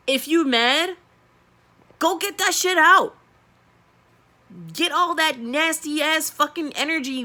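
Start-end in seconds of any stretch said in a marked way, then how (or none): 2.0-7.2 heated urging. Real, not acted. Urging anger out.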